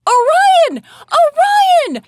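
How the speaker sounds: very high, loud shout; yelling. A narrator's reading. Mom running to her missing daughter, high urgent yell. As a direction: call the name at full pitch and volume. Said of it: "she yelled".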